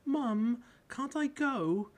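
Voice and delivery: put-on high, loud, slow child's plea; little girl pleading. A narrator's reading. Small sister begging her mum to go too, high plea. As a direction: beg like an eager small girl.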